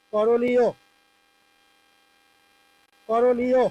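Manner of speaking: করণীয় called out loud and clear; clear model word. Shown as a demonstration. Teacher loudly modelling করণীয় for students. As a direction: announce করণীয় loudly to the class. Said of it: "teacher models the answer word".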